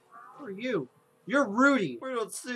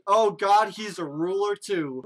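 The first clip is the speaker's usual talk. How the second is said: ordinary talk, nothing marked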